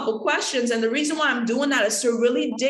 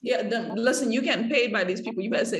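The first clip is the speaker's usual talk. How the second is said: ordinary talk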